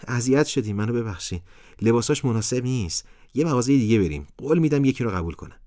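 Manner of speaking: put-on a boy eager to make amends; pleading boy. A narrator's reading. Boy apologizes, pleads with parents.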